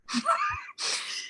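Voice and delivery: high squeaky disbelieving laugh; incredulous laugh. Real, not acted. Reaction to his proposal.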